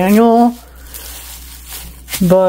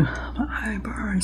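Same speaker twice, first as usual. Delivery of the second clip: whisper; 2 semitones higher, 16 dB quieter, much slower than usual. Real, not acted. Eyes stinging from onions.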